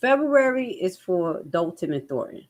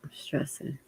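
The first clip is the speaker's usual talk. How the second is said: stunned whisper; 3 semitones lower, 10 dB quieter, much slower than usual. Real, not acted. Stunned by April election date.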